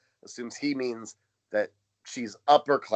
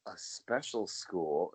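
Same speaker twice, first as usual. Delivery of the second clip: slow dawning realisation; 5 semitones lower, 5 dB quieter, slower than usual. Retold or imitated.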